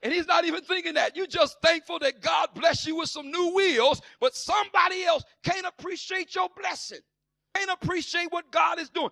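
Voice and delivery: high, loud preaching build; preaching at full force. Acted. Preacher defending the blessed, shouting. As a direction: press the point hard.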